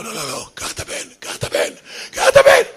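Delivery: whimpering, breaking from faint to high squeak; hammed-up tearful fright; terrified. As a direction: play a dad begging in panic. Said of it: "מפחד".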